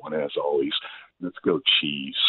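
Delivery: ordinary talk, nothing marked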